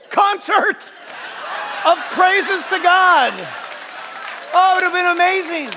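high, loud exuberance; carried away, loud and elated; excited. Real, not acted. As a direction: shout it, thrilled by the idea. Carried away imagining the rock concert.

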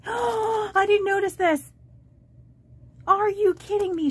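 real disbelief that jumps up in pitch; gasping surprise. Real, not acted. Discovers a hidden gift.

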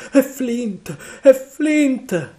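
high, loud panicked cry; terrified shout. A narrator's reading. Pirate shouting at the ghostly song, panicked. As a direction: cry out in alarm. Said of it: "gridò Mary".

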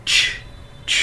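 "Ch" as a voiceless puff with no vowel; ch có luồng hơi. Shown as a demonstration. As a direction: push out a sharp hissing "ch". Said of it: "có một luồng hơi từ trong miệng". ("Ch" bật hơi, không có âm ơ)

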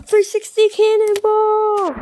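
long high excited yell; cannonball yell. Real, not acted. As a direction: belt it out as you leap. Jumping into the pool.

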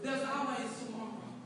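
quiet, restrained assurance; soft refrain. Real, not acted. Speaker consoling those who get stumped, soft refrain. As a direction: offer the hope gently first.

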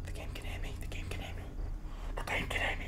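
barely audible whisper; frightened whisper. Real, not acted. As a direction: whisper so the game can't hear you. Hiding, game hears the microphone.

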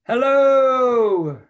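high, loud call from a mountaintop; big hello shouted across the mountains. A narrator's reading. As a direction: call out to the valley. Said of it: "and I shouted".

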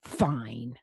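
low, grudging surrender; groan. A narrator's reading. Vampire giving in to the butler, low groan. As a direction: give in with a storybook groan. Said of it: "Louis groaned".

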